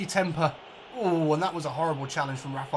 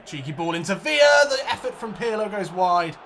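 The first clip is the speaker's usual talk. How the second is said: loud, higher call of the chance; excited commentary; 4 semitones higher, 8 dB louder than usual. Acted. Gamer calling a chance on goal, excited shout. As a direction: shout the play as it happens. Live play-by-play.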